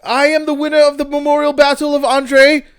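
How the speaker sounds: high, loud wrestler boast; hyped wrestler promo. Retold or imitated. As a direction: shout it like a Matt Hardy promo. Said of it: "he's like".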